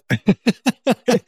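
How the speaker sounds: pained half laugh; a short laugh cut off by rib pain; laughing through pain. Real, not acted. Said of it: "give these little weird half, halfway laughs".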